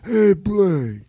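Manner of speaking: Godfather voice. Acted. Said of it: "Godfather impersonations".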